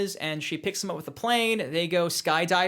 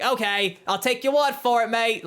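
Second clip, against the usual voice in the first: higher-pitched mock character voice, a touch louder; Kano; 5 semitones higher, 3 dB louder than usual. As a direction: put on an eager, gullible character's voice. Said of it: "Kano would be like".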